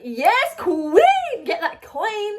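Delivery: high, hyped-up friend impression; Ben cheering. Friend egging on a would-be sugar baby, excitedly. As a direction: cheer them on in a high, gleeful voice. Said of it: "So he was like".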